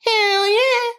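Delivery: high, stretched-out retold cheer; eager drawn-out yes. Guest retelling her answer to the trip invite, eager high drawl. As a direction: sing it out high and long, delighted.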